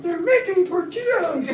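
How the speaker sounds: high, slow mock-excited squeal; freaking out. Partygoers gushing over tortillas, high mock excitement. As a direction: imitate the crowd going wild over tortillas. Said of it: "Everybody's freaking out".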